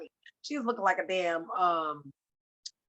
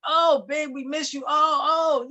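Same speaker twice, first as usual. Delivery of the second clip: higher-pitched mock wailing; imitated breakdown; 6 semitones higher, 4 dB louder than usual. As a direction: cry out like him breaking down, bursts of loud "oh". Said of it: "really having a breakdown on stage".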